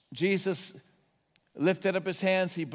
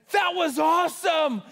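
high, loud stranger's cheer; high shouted delight; excited cheer; 9 semitones higher, 5 dB louder than usual. Retold or imitated. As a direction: cheer it out, thrilled. Curbside worker cheering.

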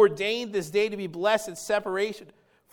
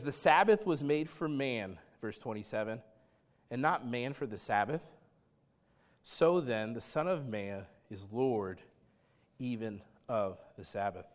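solemn scripture reading; 8 semitones lower, 7 dB quieter than usual. Acted. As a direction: read the verse low and hushed.

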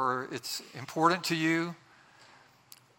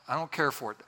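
ordinary delivery

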